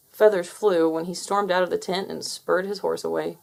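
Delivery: ordinary delivery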